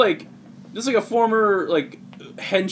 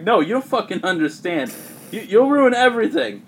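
heated retelling, pitch climbing to the end; mock angry protest; 7 semitones higher, 4 dB louder than usual. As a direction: act out an exasperated accusation, rising louder. Retold quarrel between story characters.

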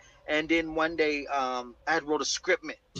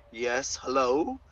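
feigned calm; 7 dB louder than usual. Retold or imitated. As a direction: act all calm while barely holding it in. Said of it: "Trying to act all calm".

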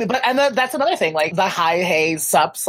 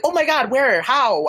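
mock surprise; 8 semitones higher, slower than usual. Shocked by instant dating app matches.

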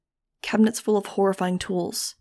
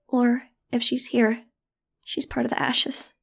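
higher, slow, cracking with grief; a boy's voice cracking in despair; voice breaking; 5 semitones higher than usual. A narrator's reading. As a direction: let the voice break on the ashes. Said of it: "His voice broke".

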